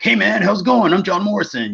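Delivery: lightly imitated friendly introduction, pitched high; mock a breezy, high-pitched hello; John Morrison. Retold friendly self-introduction by a wrestler.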